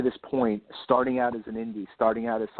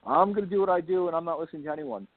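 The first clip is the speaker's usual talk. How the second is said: mock stubborn writer; 6 semitones higher, faster than usual. Mocking a closed-minded writer.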